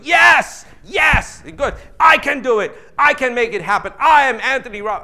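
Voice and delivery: high, loud hyped-up yelling with laughter; mock motivated screaming. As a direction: scream like you just left a seminar. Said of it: "you are screaming down the escalator".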